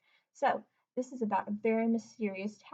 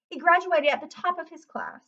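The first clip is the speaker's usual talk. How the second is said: ordinary delivery, nothing marked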